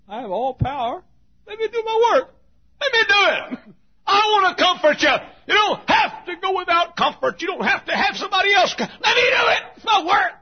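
high, loud impassioned shout; full-force preaching. Acted. Preacher speaking as the Holy Spirit to the weary, full-force shout. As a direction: cry it out insistently, building to a revival shout.

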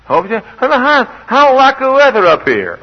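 high, loud mocking voice; mock questioner. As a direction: pitch it way up for a silly question. Voicing a silly questioner.

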